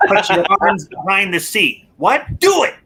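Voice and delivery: high, loud imitated command, rising to a shout; mock forceful order. Imitating the villain ordering his captive about, mock forceful, rising.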